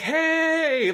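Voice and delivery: very high, held "Hey!" from afar; distant call. Retold or imitated. Imitating the host across a field, far-off high call. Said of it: "from a distance".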